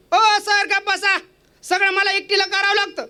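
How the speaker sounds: high, complaining wife's tirade; wife snapping back. Acted. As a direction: list every chore she does, indignant. Wife snaps back at husband.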